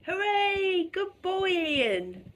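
high, bright hooray for the cat; excited praise for the cat; delighted cheer. Real, not acted. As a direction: cheer the cat on as if he just won. Cat finally settles in bed.

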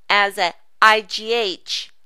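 ordinary talk

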